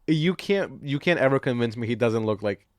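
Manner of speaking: normal talking voice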